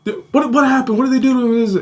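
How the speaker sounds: high-pitched, alarmed questioning; retold worry. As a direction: imitate a worried older brother. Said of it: "And he was worried".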